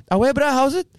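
high, chatty coloured South African accent. Shown as a demonstration. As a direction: greet a mate in coloured South African English. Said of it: "do the South African one".

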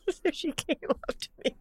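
high, squeaky words through real laughter; helpless laughter. Real, not acted. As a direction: struggle to get it out while cracking up. Cracking up at own story.

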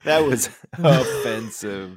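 slow, laughing through the words; amused mock reproach. Real, not acted. Laughing at friend's impression.

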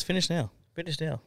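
high, insistent command; high-pitched boss order. Retold or imitated. Boss voiced ordering him.

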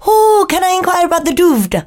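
high, put-on prank-caller voice; Dom Jolly. Co-host imitates a TV prankster at a video shop, prank-caller impression. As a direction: pipe up as a baffling comic customer. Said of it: "he'd pretend to be".

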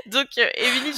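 giggling through the words; amused, still laughing after her own slip. Real, not acted. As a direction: laugh it off while passing the turn.

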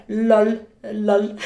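loud mock old-client lol; older client. Imitating an older client at the salon, loud mock quote. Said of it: "Before, he was like".

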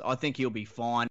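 ordinary talk, nothing marked

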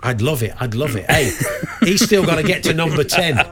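usual speaking voice

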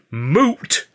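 loud, high incredulous query; indignant, rising echo; yelled disbelief. A narrator's reading. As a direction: throw the word back as a yelled question. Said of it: "I yelled".

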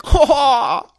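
high, loud mock laugh; Ash's laugh. Host imitating how Ash laughs at everything, mock laugh. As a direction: imitate a cartoon hero's "ha ha". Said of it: "'Cause you know how Ash laughs at everything?".

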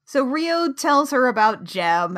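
usual speaking voice

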